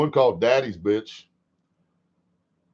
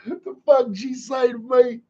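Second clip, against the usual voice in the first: high-pitched mock bewilderment; hammed-up incredulous question; baffled disbelief; 10 semitones higher than usual. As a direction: squeak it out as if totally lost. Acts out own baffled reaction.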